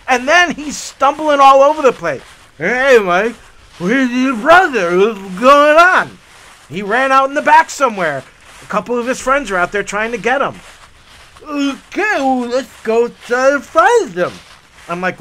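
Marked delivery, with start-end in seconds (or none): 2.6-6.2 put-on slow, loud slurry drunk voice; a lightly played drunk dad asking; drunk father. Drunk father arriving, asking Mike slowly. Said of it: "My father is drunk". 11.4-14.3 put-on drunk father. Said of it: "My father is drunk".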